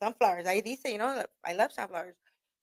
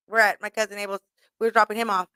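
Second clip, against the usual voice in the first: normal talking voice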